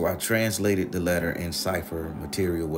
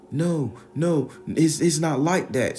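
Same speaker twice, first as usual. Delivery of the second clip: high, louder pleading protest; Ralph protesting; 9 semitones higher, 6 dB louder, slower than usual. Retold or imitated. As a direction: plead that it's not like that.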